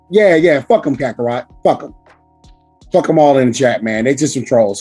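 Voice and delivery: normal talking voice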